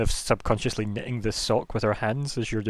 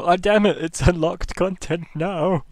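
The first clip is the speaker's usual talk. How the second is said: raised, louder mock exclamation; mock dismay; 6 semitones higher, 5 dB louder, slower than usual. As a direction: act out a comic future reaction. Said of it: "that's when we hit sixty-five and go".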